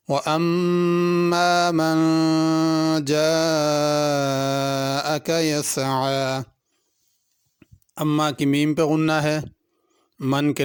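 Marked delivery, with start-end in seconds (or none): 0.1-6.4 slow, drawn-out melodic tajweed chant; Quran recitation. Acted. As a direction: recite slowly with long stretched vowels.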